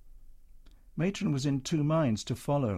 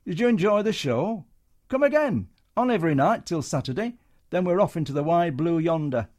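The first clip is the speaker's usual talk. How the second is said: showman brush-off; 5 semitones higher, 4 dB louder than usual. Acted. Comedian brushing soldier off.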